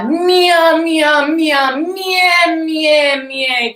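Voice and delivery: high meows sliding down; playful cat meow. Shown as a demonstration. Teacher showing students to explore voice with meows. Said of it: "explore your voice".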